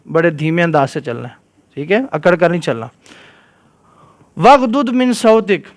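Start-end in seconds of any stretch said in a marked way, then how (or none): 4.4-5.7 Quran recitation. Acted. Teacher reciting the verse to the children in Quran style.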